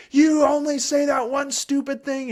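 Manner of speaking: put-on high, loud whiny kid taunting; mocking child. Child mocking parent's repeated phrase, high loud whine. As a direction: sneer it like a fed-up child. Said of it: "they'll start to say".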